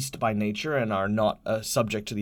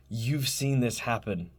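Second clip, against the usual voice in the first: normal talking voice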